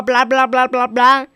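loud dismissive blah-blah; mocking grumble. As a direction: wave it all off. Mother grumbling to herself.

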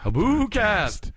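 rising ghostly boo; spooky ghost wail. Pretend.

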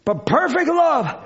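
normal talking voice

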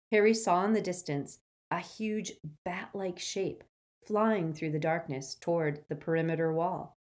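ordinary delivery, nothing marked

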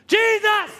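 high, insistent cry; calling out. Acted. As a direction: call out over those hushing you. Acted blind man calling out.

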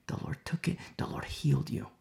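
tender whispered assurance. Real, not acted. Preacher assuring the congregation, whispered. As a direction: whisper it as comfort.

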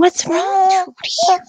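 high sing-song concern for a little one; doting aside. Real, not acted. Turns aside to soothe someone nearby.

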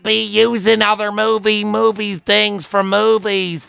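high, know-it-all character voice; high-pitched pedantic movie nerd; nerdy high voice. Retold or imitated. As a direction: lecture in a high nasal-free geek voice. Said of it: "and he goes".

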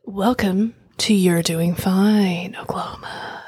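very slow, drawn-out announcer parody; exaggerated intro voice. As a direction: stretch every word like a radio host. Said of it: "if we tried to talk like that the whole time".

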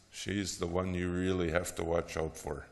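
ordinary delivery

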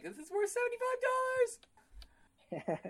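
very high, slow gloating; gleeful gloat. Retold or imitated. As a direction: savor the con slowly. Gloating over a rigged trade.

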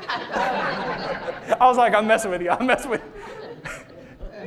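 telling it through laughter; laughing. Real, not acted. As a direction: let the laugh break into the words. Tells his prank, audience laughing.

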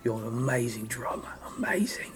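put-on British accent, trailing to a hush. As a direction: imitate an impressed Englishman. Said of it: "he talks like this right here".

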